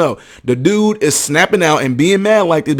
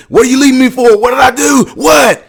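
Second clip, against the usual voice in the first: loud, high mock yell of protest; imitating yelling; 9 semitones higher, 5 dB louder than usual. Retelling a man's breakup outburst to viewers, imitated yelling. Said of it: "he's yelling out like that".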